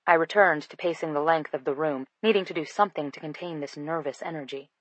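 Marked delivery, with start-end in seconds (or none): none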